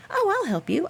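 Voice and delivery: high, breezy offer; eager young guy. Retold or imitated. Canadian guy volunteering to help, a high breezy voice. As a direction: toss off the offer like it's nothing. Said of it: "And he's like".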